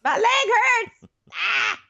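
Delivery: slow, high hammed-up shout of pain; exaggerated whiny yelling; mock wailing. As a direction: drag it out in a high imitated wail. Repeats the wailing impression.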